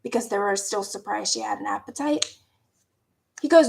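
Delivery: ordinary talk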